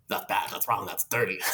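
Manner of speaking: a low, hushed imitation of the inner shaming voice; sex negative voice. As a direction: growl it low like a grim inner critic. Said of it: "That's what my sex negative voice sounds like".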